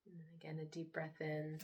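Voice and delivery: soft, unhurried meditation voice with long pauses; guided meditation. Acted. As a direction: hush down and gently lead them into relaxation. Leads a guided relaxation.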